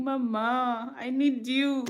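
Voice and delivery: put-on high, plaintive baby voice; imitated pleading baby. Mother voicing what her baby's cry means, high pleading. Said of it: "he's saying".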